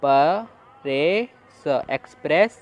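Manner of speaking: slow split प, रे, स then blended एक्सप्रेस; sounding out. Shown as a demonstration. Teacher sounding out syllables for the class. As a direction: drag each syllable then say एक्सप्रेस whole. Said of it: "तो क्या बना".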